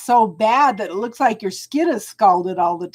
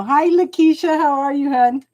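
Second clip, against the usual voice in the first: ordinary delivery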